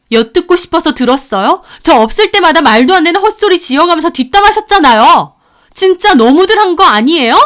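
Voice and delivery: building from retort to loud accusation; angry protest. Acted. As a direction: protest hotly at being wronged. Daughter-in-law protesting, angry quarrel.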